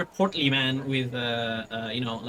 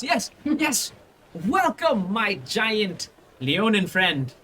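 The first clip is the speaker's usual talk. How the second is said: Volo, effusive; 6 semitones higher than usual. Acted. Volo greeting the answerer of his flyer, eager. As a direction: gush over the adventurer. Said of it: "he just goes like".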